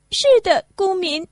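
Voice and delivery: put-on high, loud little girl's answer; child's voice. Little Lucy answering the woodsawyer, high. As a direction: pipe up brightly like a small child.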